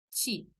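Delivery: T before I said as chi; chi sound. Shown as a demonstration. Teacher showing Brazilian ti as chi. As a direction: turn ti into chi. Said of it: "un sonido como T Y I".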